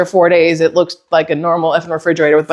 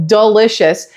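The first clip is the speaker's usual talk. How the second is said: normal talking voice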